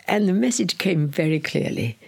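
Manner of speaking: usual speaking voice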